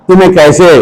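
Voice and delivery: ordinary talk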